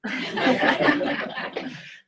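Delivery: loud, high burst of laughter; real laugh bursting out. Real, not acted. Lecturer reacting to an audience remark, laughing out loud.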